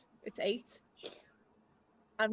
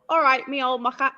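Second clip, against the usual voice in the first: loud, badly faked Cockney greeting; attempted London accent; 2 semitones higher, 9 dB louder than usual. Shown as a demonstration. Liverpool speaker trying the accent for a friend, mock Cockney. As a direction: belt out a hammy London accent, half laughing. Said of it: "I can't do the accent".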